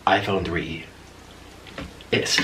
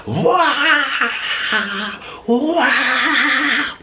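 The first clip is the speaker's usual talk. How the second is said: high, loud mock scream; imitated screaming; 4 dB louder than usual. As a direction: wail like a screaming mob. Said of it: "all of them start screaming".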